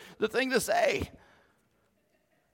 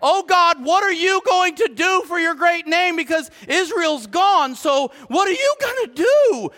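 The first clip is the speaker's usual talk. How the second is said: high, loud, pushy demand of God; imitated brash questioning, pitched high; challenging God; 7 semitones higher, 4 dB louder than usual. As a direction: act out Joshua cornering God. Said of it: "Joshua is lucky to be alive".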